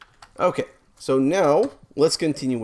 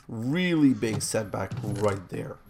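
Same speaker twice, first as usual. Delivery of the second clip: ordinary talk, nothing marked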